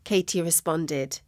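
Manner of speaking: ordinary delivery, nothing marked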